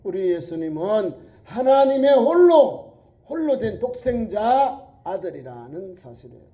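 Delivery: loud, high, slow emphasis; preaching at full force. Acted. Preacher stressing the only Son, slow and loud. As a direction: linger on 홀로.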